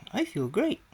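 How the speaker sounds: high and loud with delight; excited. Retold or imitated. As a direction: burst out happily. Reacting to hearing Ernie.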